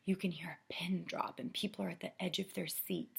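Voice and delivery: hushed, soft pin-drop delivery; soft, confidential hush. Shown as a demonstration. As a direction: drop to a near-whisper and draw them in. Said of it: "and I lower my voice".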